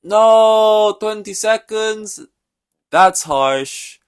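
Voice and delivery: high, loud, slow fake outrage; mock-surprised Max. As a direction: ham up a fake protest. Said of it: "and Max is like".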